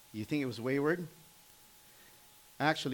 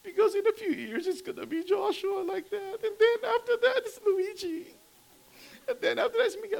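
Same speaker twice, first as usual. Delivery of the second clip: high, crying, breaking voice; wife crying over her sons growing up; tearful wife; 6 dB louder than usual. Retold or imitated. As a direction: sob it out as the worried mother. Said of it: "and she was crying".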